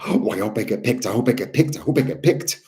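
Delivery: rising, eager pleading; eager hoping. Retold or imitated. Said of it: "they have that energy of like".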